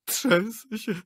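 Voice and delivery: high, tearful blubbering; sobbing. A narrator's reading. Director to actress, sobbing in fear. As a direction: weep and shake. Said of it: "zaczął szlochać".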